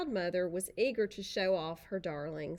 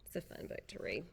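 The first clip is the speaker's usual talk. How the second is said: usual speaking voice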